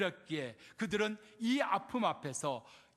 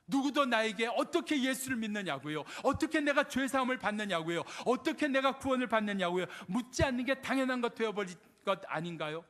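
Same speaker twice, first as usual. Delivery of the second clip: high, insistent sermon cadence; forceful preaching; 4 semitones higher, 4 dB louder than usual. Acted. Preacher lamenting that nobody asks about salvation, forceful preaching. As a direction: pile up each question with urgency.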